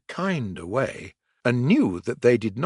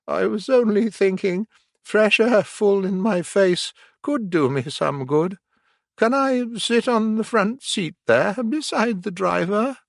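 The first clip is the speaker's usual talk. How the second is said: sweet washerwoman voice; 9 semitones higher than usual. A narrator's reading. Toad as washerwoman.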